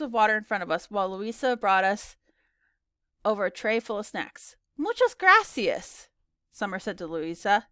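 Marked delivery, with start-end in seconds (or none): 4.8-6.1 high, loud, stretched-out thanks; raised-pitch, drawn-out Spanish thank-you; effusive thanks. Acted. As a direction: thank her slowly and keenly. Guest thanks babysitter in Spanish.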